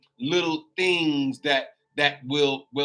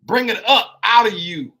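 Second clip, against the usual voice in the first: full-force preaching; 3 semitones higher than usual. Acted. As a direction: lift the congregation, preach it out.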